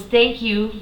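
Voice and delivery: little voice. Retold or imitated. Man calling thanks to departing hotel worker, imitated little voice. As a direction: lightly voice a small, polite speaker. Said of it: "I hear this little voice go".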